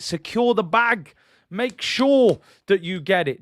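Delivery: slow, emphatic demand; insistent urging. Real, not acted. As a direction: press every word home. Urging the club to act.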